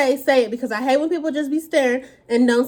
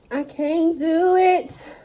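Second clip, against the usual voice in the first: can't eat more; 5 semitones higher, much slower than usual. Real, not acted. Too full to eat more.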